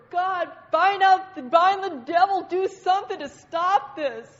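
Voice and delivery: loud, high mock panic; hammed-up emergency shouting, stretched for drama; frantic calling out. Ministry team praying over woman in pain, frantic mock shouting.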